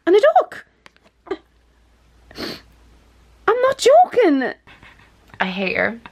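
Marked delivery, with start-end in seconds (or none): 0.1-4.5 high, loud disbelieving squeal; excited shriek. Real, not acted. Friend finding the winning ducks, shrieking. Finds the third duck.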